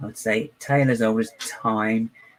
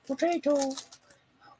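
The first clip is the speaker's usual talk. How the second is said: squeaky potato; 6 dB quieter, much slower than usual. Pretend. Sung-out silly potato.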